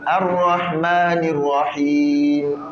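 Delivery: slow, chanted tajwid recitation; Quran recitation. Acted. Teacher recites the Fatiha for students to follow. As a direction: recite the Fatiha slowly, melodically.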